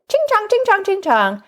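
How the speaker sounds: high, sing-song mock chant; mocking slur imitation. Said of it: "he was sort of doing this".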